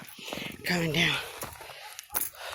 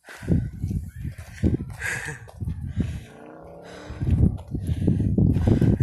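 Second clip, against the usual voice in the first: breathy laugh; 2 semitones lower than usual. Real, not acted. Laughing at passing hikers.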